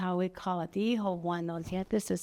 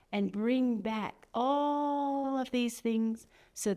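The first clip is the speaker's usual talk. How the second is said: "all" stretched very long and held higher; drawn-out ALL; 4 semitones higher, much slower than usual. Acted. Instruction with drawn-out word.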